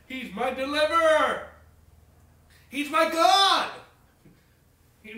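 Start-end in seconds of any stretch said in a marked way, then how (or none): 0.1-3.7 higher, louder and slow on God; triumphant pulpit cry; preaching climax. Acted. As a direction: lift the psalm to a shout of praise. Climax of the psalm recital.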